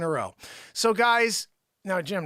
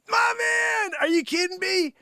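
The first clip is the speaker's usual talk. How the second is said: much higher excited call; excited greeting; 5 dB louder than usual. Real, not acted. Host cheering a loyal viewer, high and excited. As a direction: greet him with a delighted yell.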